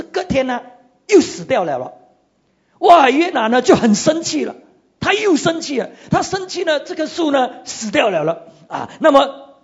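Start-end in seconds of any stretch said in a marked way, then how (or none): 2.8-6.8 raised higher and louder to tell of the anger; animated, rising narration. Acted. Preacher telling how Jonah got angry again, animated. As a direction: lift the voice as Jonah's temper flares.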